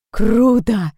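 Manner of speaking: loud, high, drawn-out cry of awe; delighted exclamation. A narrator's reading. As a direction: exclaim it, thrilled. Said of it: "с восторгом воскликнул Фред".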